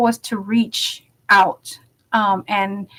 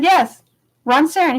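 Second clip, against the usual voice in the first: emphatic insistence; 7 semitones higher than usual. Retold or imitated. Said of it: "I said".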